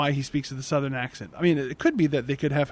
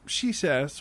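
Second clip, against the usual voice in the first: usual speaking voice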